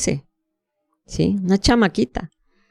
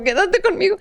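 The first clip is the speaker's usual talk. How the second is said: the same plea much higher, near breaking; desperate high plea; 13 semitones higher than usual. Retold or imitated. Bride begging the servant a second time, much higher and desperate. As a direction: beg again, far more desperate. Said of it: "porque él decía".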